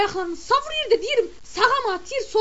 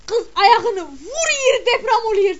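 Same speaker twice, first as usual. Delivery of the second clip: slow, louder line broken by laughter; laughing; 5 semitones higher, 7 dB louder, slower than usual. Real, not acted. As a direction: laugh through the words. Laughing while telling.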